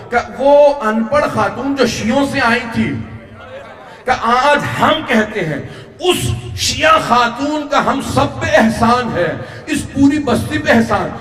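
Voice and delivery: high, drawn-out sermon climax; slow, elevated majlis oratory; impassioned preaching. Acted. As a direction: lift the voice high and stretch the phrases for the congregation.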